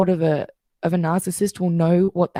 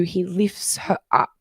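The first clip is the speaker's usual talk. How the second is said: ordinary delivery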